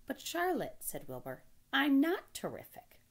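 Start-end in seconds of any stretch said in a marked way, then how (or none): 0.1-0.8 high-pitched pleading piglet; protesting young pig. Acted. Wilbur protests being called terrific. 1.7-3.0 high, loud, slow piglet protest. Acted. Wilbur protests.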